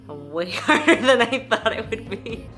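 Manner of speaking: laughing through the words; breathless laugh mid-sentence. Real, not acted. Laughing after workout.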